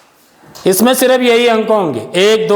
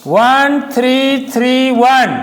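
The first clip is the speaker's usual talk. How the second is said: slow spelled-out digits; much slower than usual. Shown as a demonstration. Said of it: "ऐसी एक संख्या और लिखता हूँ".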